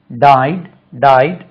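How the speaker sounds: usual speaking voice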